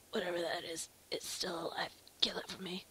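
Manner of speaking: low whispery croak; injured character. Acted. Wounded Thomas asks Arthur to kill it, low mock-dying whisper. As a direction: croak it out as if fading.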